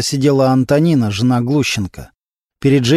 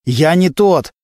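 desperate shouting; 5 semitones higher than usual. A narrator's reading. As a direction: shout after him as he leaves. Suspect shouting after captain.

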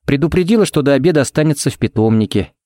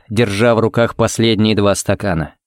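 ordinary delivery

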